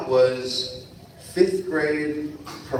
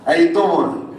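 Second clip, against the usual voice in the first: higher, louder Jersey "how you doing"; New Jersey accent; 5 semitones higher, 6 dB louder than usual. Retold or imitated. Guest speaker reprising his Jersey greeting to the kids, New Jersey accent. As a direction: toss off the Jersey greeting again with a laugh.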